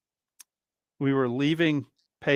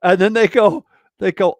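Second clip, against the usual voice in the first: normal talking voice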